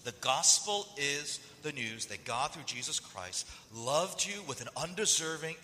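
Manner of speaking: normal talking voice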